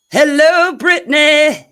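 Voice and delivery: loud greeting. Real, not acted. Calling out to new guest.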